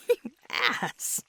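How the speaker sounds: loud, high and laughing; teasing shout broken by laughs; laughing mock outrage. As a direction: shoo them off playfully through bursts of laughter. Playful scolding amid laughter.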